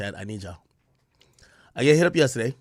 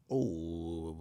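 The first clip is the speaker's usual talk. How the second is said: ordinary delivery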